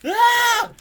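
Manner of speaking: high, loud shocked scream. A narrator's reading. Gobber screams in shock at the corpse, high and loud. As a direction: leap three feet and scream. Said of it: "screamed Gobber the Belch".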